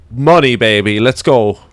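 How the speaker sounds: loud triumphant cheer; excited cheer. Real, not acted. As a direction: celebrate out loud like a win. Finds the answer he wanted.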